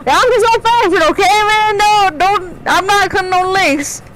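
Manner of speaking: lightly hammed Southern accent; mock Southern accent. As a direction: playfully copy his Southern act. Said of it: "just getting aggressively Southern".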